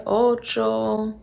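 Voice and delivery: ordinary delivery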